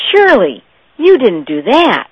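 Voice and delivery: loud, aghast disbelief; horrified protest. A narrator's reading. Parish priest protests aghast to his associate.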